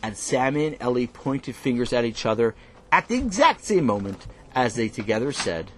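plain reading voice

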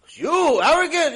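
high, loud incredulous exclamation; acted disbelief. Rabbi reacting to a man called arrogant, loudly incredulous. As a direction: blurt it out in mock astonishment. Husband retold as arrogant, amazed.

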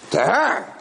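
high, mocking reveal; taunting announcement. Master revealing the bride to kitchen boy, taunting announcement. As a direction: point her out in a high taunt.

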